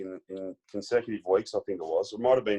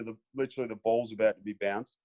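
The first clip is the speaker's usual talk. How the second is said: ordinary talk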